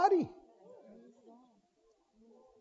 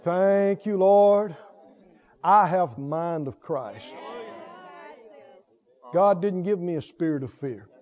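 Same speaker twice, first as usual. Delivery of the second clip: low, measured prayerful affirmation; spoken faith declaration; 6 semitones lower than usual. Acted. Preacher modelling what to say when symptoms come, calm declaration. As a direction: affirm it steadily to God, low and assured.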